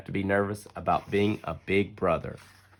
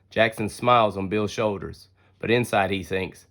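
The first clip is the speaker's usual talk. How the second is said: plain reading voice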